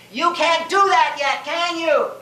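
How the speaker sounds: high-pitched rhetorical dare; taunting challenge. Acted. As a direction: taunt the audience with it. Repeats the taunt to audience.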